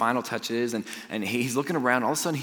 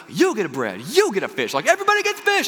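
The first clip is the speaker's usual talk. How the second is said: big, swooping giveaway announcement; Oprah Winfrey; 7 semitones higher, 4 dB louder than usual. Acted. Jesus handing out fish to the crowd, Oprah style. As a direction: hype the crowd, soaring high on the last gift. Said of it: "Oprah style was like".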